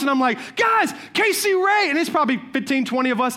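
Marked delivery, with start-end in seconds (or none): 0.6-1.9 high, drawn-out excited call; raised voice. Retold or imitated. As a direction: call out to a noisy party, retold. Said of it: "I start raising my voice".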